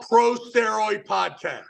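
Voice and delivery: usual speaking voice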